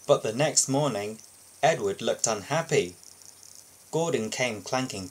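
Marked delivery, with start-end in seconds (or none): none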